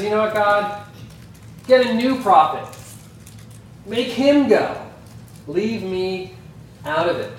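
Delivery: petulant prophet refusing, voiced lightly; sulky Jonah. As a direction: act out Jonah brushing God off. Jonah telling God to leave him.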